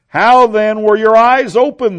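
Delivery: high, loud demand. A narrator's reading. Neighbors questioning the healed man, demanding. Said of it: "they demanded".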